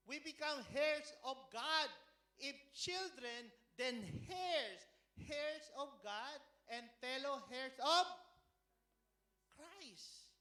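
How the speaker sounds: loud, slow, ringing proclamation; proclaiming scripture. Acted. Preacher proclaiming to the congregation, loud and slow. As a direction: declare it to the whole church.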